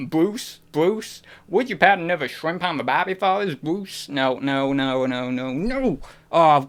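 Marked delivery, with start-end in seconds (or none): 0.1-4.1 high caricature Aussie with rising names; Australian accent. Shown as a demonstration. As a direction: overplay the Australian upward lilt. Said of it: "I couldn't do Australian".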